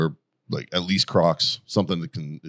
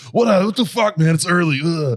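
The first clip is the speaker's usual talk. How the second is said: high, loud mock whine at being woken; retold groggy complaint; 10 semitones higher, 5 dB louder than usual. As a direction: protest the early wake-up, hammed-up and high. Voices himself grumbling when woken early.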